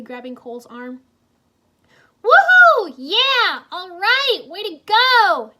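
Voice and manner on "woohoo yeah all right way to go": very high, loud whooping cheers; cheering. A narrator's reading. Jay cheers Wu riding the dragon, loud whooping cheer. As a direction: cheer like a fan at a win. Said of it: "Jay cheered".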